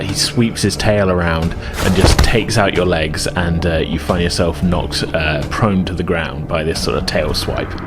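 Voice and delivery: normal talking voice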